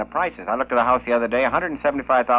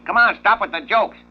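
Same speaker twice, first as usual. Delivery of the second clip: higher, louder, losing patience at the door; exasperated plumber; 10 semitones higher, 5 dB louder than usual. Acted. Plumber shouting at the parrot behind the door, exasperated and raised. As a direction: yell through the door, fed up. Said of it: "this gets more excitable".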